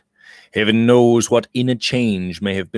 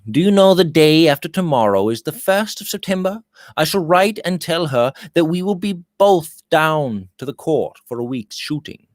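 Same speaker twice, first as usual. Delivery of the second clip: put-on breezy young gentleman, pitched higher; lively higher young man's voice; Robert's voice; 7 semitones higher than usual. A narrator's reading. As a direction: lift the voice for cheerful Robert.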